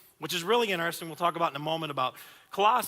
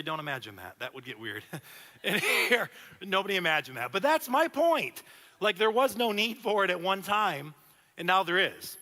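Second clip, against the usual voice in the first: usual speaking voice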